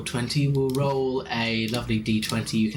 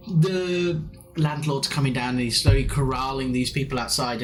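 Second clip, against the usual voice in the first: ordinary talk, nothing marked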